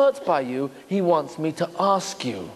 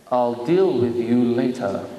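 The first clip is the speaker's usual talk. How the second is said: stern low aside; 9 semitones lower than usual. Pretend. God voiced deferring Adam.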